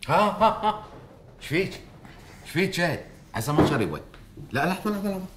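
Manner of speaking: sudden high-pitched alarmed calls; urgent, startled concern. Acted. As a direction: react in a panic to someone faltering. Alarmed as woman nearly collapses.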